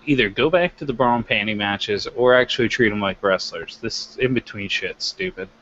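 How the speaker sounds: ordinary talk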